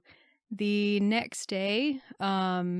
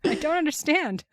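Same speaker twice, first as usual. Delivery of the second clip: high, louder playful bafflement; mock bewilderment; 8 semitones higher, 5 dB louder than usual. Co-host plays filmmaker baffled by the budget, mock-bewildered. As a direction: play a clueless filmmaker, hammed up.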